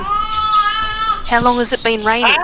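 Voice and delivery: How long has it been raining, high, slow and loud; hammed-up child. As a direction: stretch it out like a whining kid. Repeats line as character.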